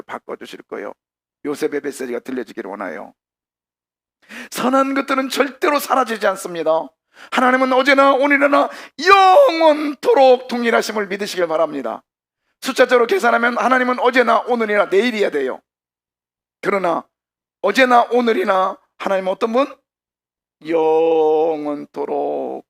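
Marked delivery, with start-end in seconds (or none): 4.5-19.7 high, loud, emphatic sermon delivery; high-pitched preacher's shout; full-force preaching. Real, not acted. 20.7-22.6 영원토록 stretched very slowly; slow, sustained 영원토록; drawn-out proclamation. Real, not acted. Preacher draws out the word.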